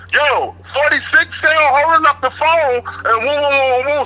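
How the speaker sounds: high, slow mock yell down the tier; imitating a guard's high drawn-out holler; retold yell. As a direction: stretch it out high like a guard calling a number.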